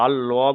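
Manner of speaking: "আল্লাহ" said as a heavy "Al-lo"; thick Allah. Shown as a demonstration. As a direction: round the L of "আল্লাহ" into "lo". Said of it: "যদি ল উচ্চারণ করি তাহলে পুর". ("আল্লাহ" পুর করে, "আল্ল")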